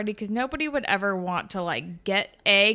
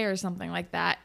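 ordinary delivery

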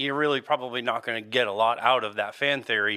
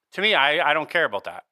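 ordinary delivery

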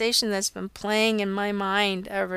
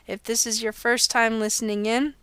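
normal talking voice

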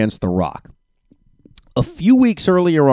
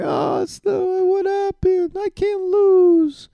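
high, slow, whiny imitation of crying; mock crying; much slower than usual. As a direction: whimper it out in a mocking, much higher voice. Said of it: "And now Bayley was crying, and she's like".

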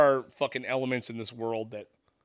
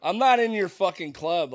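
loud, raised-pitch retort; indignant rebuff; 4 semitones higher, 7 dB louder, slower than usual. Retold or imitated. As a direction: push back hard, stretching the refusal. Acts out rebuff to racist remarks.

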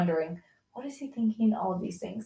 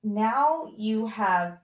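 very slow, with long weighty pauses; grave, deliberate confrontation; 2 semitones higher, much slower than usual. Retold or imitated. Mother telling son of lost trust, slow and grave. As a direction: spell it out slowly, stopping between phrases.

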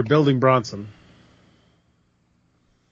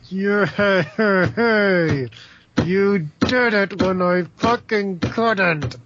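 mock Bayley gloat; 7 semitones higher, much slower than usual. Bayley sarcastic mock cheer.

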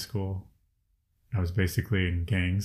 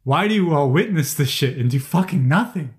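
higher, louder, angry demand at bystanders; indignant outburst; 6 semitones higher, 5 dB louder than usual. Real, not acted. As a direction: let the frustration burst out as you ask why. Venting anger about bystanders.

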